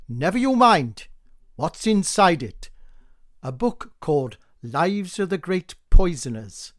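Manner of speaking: loud, curt snap; a nosy wife snapping at the postman; sharp snapping retort. Acted. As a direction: cut him off sharply. Sharp exchange over parcel.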